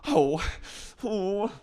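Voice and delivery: high, fluttery hesitation; prim Charlotte. Retold or imitated. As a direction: flutter like a nervous good girl. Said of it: "Charlotte goes".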